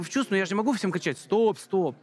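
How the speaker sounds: ordinary talk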